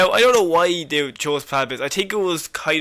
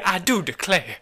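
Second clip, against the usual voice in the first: half-hearted Southern belle, barely shifted from her own voice; bad southern accent; 2 semitones higher than usual. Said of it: "that's a terrible accent".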